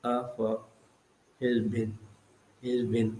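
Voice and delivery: "his been" dropped low and soft, neither word accented; unstressed syllables. Shown as a demonstration. Teacher showing a pyrrhic, "his been" low and unstressed. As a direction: let "of", "his" and "been" fall away weakly. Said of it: "two consecutive unstressed syllables".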